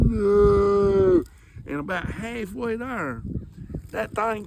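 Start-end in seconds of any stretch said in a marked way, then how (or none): 0.1-1.2 long loud higher slow-motion yell; a hammed-up cinematic cry of dread; movie-style no. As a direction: shout it like a movie hero too late. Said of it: "I'm going".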